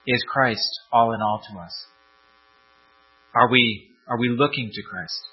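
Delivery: soft, low reverent prayer voice. Real, not acted. Pastor praying quietly over the church. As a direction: pray gently over the congregation. Closing prayer before sermon.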